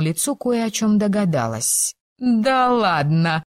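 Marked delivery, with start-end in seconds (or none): 2.2-3.4 slow, breathed-out amazement; stunned gasp. Acted. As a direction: exhale it in shock. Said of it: "потрясённо выдохнула она".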